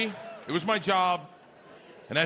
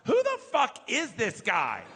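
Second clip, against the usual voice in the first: a little too loud; 6 semitones higher than usual. Retold or imitated. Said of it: "said just a little too loud".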